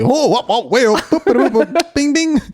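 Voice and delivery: high, goofy comic sound effects; clown noises. Retold or imitated. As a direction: babble silly boops and bings in a high voice. Acts clown gags answering bad news.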